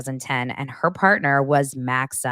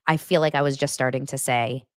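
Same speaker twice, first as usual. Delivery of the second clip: usual speaking voice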